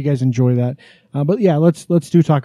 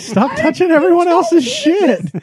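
scolding. Real, not acted. Scolding someone touching the soundboard.

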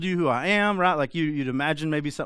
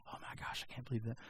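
a soft, unvoiced muttered grumble; mock whispered complaint; 2 semitones lower, 18 dB quieter, faster than usual. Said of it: "not like".

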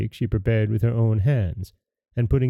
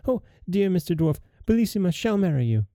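desperate plea; 8 semitones higher, faster than usual. A narrator's reading. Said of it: "cried out as loud as she could".

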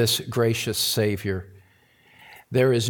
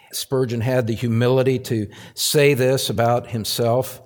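normal talking voice

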